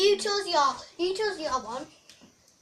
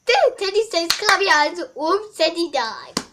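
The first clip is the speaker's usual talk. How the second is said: loud talking toy; 4 semitones higher, 7 dB louder than usual. Pretend. Loud teddy voice.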